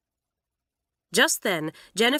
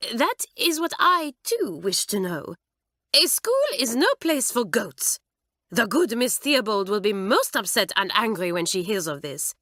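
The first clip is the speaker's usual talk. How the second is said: high, loud, huffy French teacher; indignant French mistress; 4 semitones higher, 3 dB louder than usual. A narrator's reading. Mamselle backing Margaret up against Kitty, indignant. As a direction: stand on your dignity, French-style.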